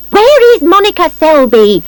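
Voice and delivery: high shouted demand. A narrator's reading. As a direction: shout it as an accusation. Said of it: "Missus Nosey shouts".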